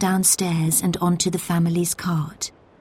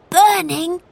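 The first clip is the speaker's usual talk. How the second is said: high, startled rising question; exclaiming; 11 semitones higher, 3 dB louder than usual. A narrator's reading. Boy reacting to the smell of burning, exclaiming. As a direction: exclaim it as a question, pitched right up. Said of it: "Robert exclaimed".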